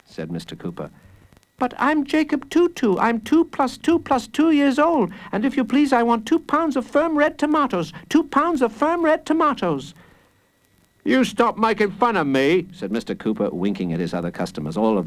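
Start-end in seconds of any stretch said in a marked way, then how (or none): 1.6-9.9 put-on high child voice; high-pitched, insistent kid; earnest little boy. A narrator's reading. Small boy explains himself. 11.0-12.7 raised, put-on shopkeeper voice; higher-pitched affronted scolding; indignant grocer. A narrator's reading. Grocer mock-scolds boy.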